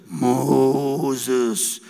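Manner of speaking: deep, booming call from the film; voice of God. Retold or imitated. God calling Moses from the old film, deep and booming. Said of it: "viene Moisés y dice".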